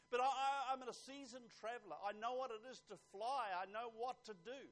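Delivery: loud, high, acted self-important traveler; insistent, puffed-up objection; indignant protest. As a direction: protest like you know it all. Traveler protests when asked for ticket.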